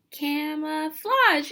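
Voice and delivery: "Camouflage" drawn out slowly, syllable by syllable; slow spelled-out word. Shown as a demonstration. Teacher slowly pronouncing the new word for students. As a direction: stretch out "Camouflage" for the kids. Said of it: "What's that fancy word".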